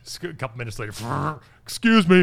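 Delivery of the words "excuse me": a loud, sing-song polite excuse me; loud mock polite. Student repeating fake apology to the teacher, louder and innocent.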